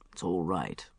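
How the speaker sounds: low, soft soothing hush; comforting. Acted. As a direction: hold her and calm her down. Mark comforting sobbing Bridget.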